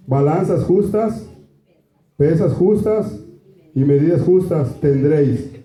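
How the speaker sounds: slow, solemn Bible reading; scripture reading. Acted. Pastor reads Leviticus to the congregation, slow and solemn. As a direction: lay down each law slowly and gravely.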